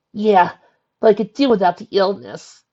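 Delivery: loud, high irritated snap; snapping. A narrator's reading. Sick Twilight answering Sunset over the phone, snapping. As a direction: snap it out, sick of being ill. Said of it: "she snapped".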